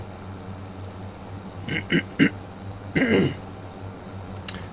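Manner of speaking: gruff throat-clearing grunt. Real, not acted. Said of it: "Sorry about this grunting".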